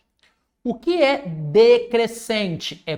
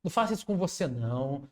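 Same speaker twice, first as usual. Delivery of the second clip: much softer, gentle scolding; soft fatherly plea; 7 semitones lower, 10 dB quieter, much faster than usual. Acted. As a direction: gently talk the student out of it. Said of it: "soft fatherly advice to student". (conselho paternal, bem baixinho)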